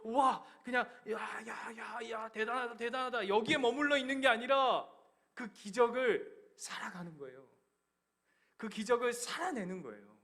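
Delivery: loud, high, impassioned sermon shouting; fiery pulpit climax, almost shouting; preaching at full force. Acted. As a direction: preach it out at the top of your voice.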